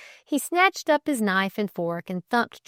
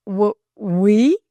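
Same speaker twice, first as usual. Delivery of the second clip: loud, stuttered startled question; stammered; 3 semitones higher, 10 dB louder than usual. A narrator's reading. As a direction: trip on the first sound, taken aback. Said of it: "stammered Delcote".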